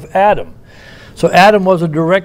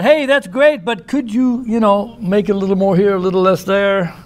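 higher-pitched, lightly played Adam; mock polite request; 4 semitones higher than usual. Adam hypothetically asking God to alter Eve, mock polite request. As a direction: voice a hypothetical nitpicker, pitched up and softening as it goes. Imagined Adam tweaking God's work.

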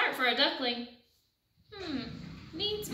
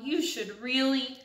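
ordinary delivery, nothing marked

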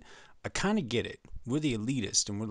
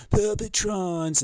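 high, loud, stretched-out call; drawn-out showy shout; exclaimed announcement; 10 semitones higher, 5 dB louder, much slower than usual. Acted. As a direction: sing out the invented name with relish. Exclaims the invented name.

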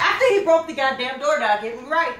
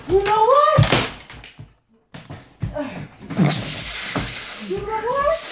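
exasperated buildup; 5 semitones higher than usual. Retold or imitated. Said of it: "and then I say".

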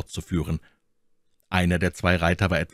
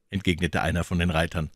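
ordinary delivery, nothing marked

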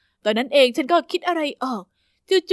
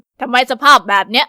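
loud disapproving snap; scolding principal; 2 semitones lower, 8 dB louder than usual. Acted. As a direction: scold the new girl for her outfit. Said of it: "ขมวดคิ้วยิ่งกว่าเดิม".

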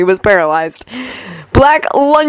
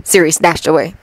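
plain reading voice

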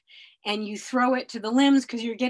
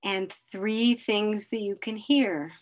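slow, calm grounding guide; guided meditation; slower than usual. Acted. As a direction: lead them slowly through the senses. Leads a grounding exercise.

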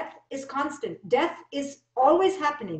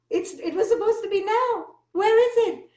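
mock bewilderment; 9 semitones higher, 4 dB louder than usual. Mock bewildered search for the promised now.

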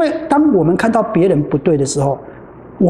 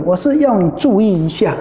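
normal talking voice

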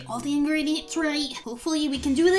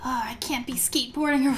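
normal talking voice

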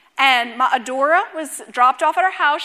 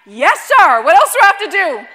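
mock obedient; 6 semitones higher, 11 dB louder than usual. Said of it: "And I'm like".

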